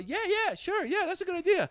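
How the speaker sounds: high, loud, overdone yes; fake eager agreement. Acted over-eager yes to an unwanted request.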